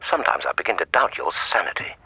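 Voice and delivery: dry, whispered aside; muttered whisper. Acted. Annoyed expert to the detective, a whispered jab. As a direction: breathe the insult under your breath.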